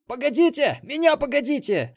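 high, loud yelling put-on voice; завопила. A narrator's reading. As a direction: wail for them to wait, very high. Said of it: "завопила летучая мышь". (истошный высокий вопль)

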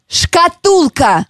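very slow, over-articulated; slow exaggerated mouthing. Shown as a demonstration. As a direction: stretch every syllable for lip-reading. Mouthing the word for lip-reading.

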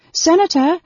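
high, loud call after someone. A narrator's reading. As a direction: call out, trying to keep calm. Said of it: "called, trying to keep calm".

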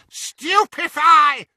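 high, slow shouted spell; screamed spell. A narrator's reading. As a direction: yell the curse out long. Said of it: "she screamed".

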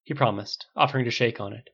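ordinary delivery

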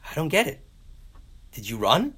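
high, loud acted puzzlement; baffled questioning. Seer baffled that man survived.

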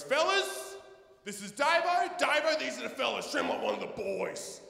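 matey introduction. Retold or imitated. Dealer introducing Davo to the fellas, hearty voice. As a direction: announce the newcomer like a proud mate.

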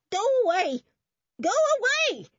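high, loud scream; screaming old woman. A narrator's reading. Old woman driving off policemen, screaming high. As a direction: shriek it like a frightened old woman. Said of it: "screamed the old woman".

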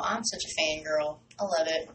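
ordinary delivery

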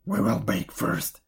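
low, put-on old woman's voice; commanding witch. A narrator's reading. Witch readying the oven before Gretel, low and commanding. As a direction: give orders as the wicked old witch.

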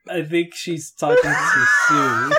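pushing words out through loud laughter; half-shouting while cracking up; laughing. Real, not acted. Laughing fit after wife beater joke.

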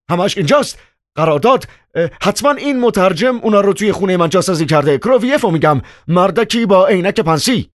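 frantic, high-pitched babble; distracted shouting. A narrator's reading. Chairman blames the interpreter while searching, frantic and high. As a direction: cry out in scattered, high-voiced panic.

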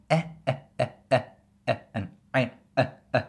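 short E sounds cut off in the throat; glottal stop sounds. Shown as a demonstration. Teacher showing learners what a glottis can produce, glottal stops. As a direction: say E as short vowels stopped by the glottis. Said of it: "You can also make sounds like".

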